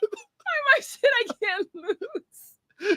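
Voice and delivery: high, slow, whiny sob; mock crying whine. Mocking a viewer's super chat.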